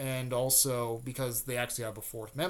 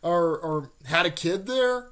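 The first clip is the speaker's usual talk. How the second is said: loud, higher-pitched teasing disbelief, breaking into a laugh; mock-teasing; 5 semitones higher, 6 dB louder than usual. Naruto ribbing Kiba about dating Ruko, loudly teasing. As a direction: rib a friend with amused, exaggerated surprise. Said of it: "like, makes fun of".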